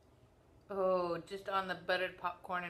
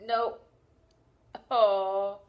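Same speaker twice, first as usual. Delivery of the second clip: disgusted groan; 3 semitones higher, 11 dB louder than usual. Real, not acted. Tasting rotten egg bean.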